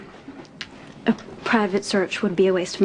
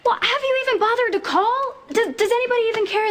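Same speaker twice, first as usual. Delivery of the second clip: higher, fast, indignant questions; upset pleading; 10 semitones higher than usual. Acted. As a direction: push back hard at the family, pitched up. Family quarrel over runaway Dylan.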